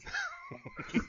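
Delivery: shrill creepy giggle; high-pitched laugh. Retold or imitated. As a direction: squeal out a disturbing laugh. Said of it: "high-pitched laugh that he would do".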